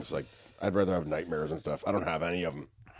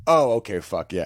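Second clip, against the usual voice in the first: ordinary talk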